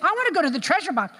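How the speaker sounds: put-on high, whiny kid begging; high childlike whine; pleading child. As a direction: beg like a child left out. Child pleading for treasure box.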